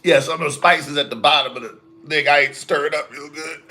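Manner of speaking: rough, irritated throat voice; talking through a scratchy throat; hoarse after coughing. Real, not acted. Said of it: "one of the peppers in your throat".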